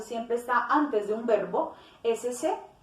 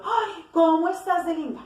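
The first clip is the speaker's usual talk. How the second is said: higher and louder, gushing over how pretty she looks; admiring exclamation; 6 semitones higher, 4 dB louder than usual. Shown as a demonstration. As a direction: exclaim it with delight, not as a question. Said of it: "cuando es una expresión de las emociones". (exclamación de admiración, más aguda y fuerte)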